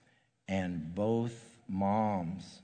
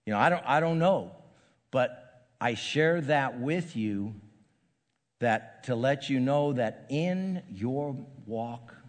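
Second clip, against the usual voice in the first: raised, higher preaching voice; preacher urging the congregation about faith, raised and higher; 4 semitones higher, 3 dB louder than usual. Acted. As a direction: lift it up and exhort the congregation. Preacher lifts into exhortation.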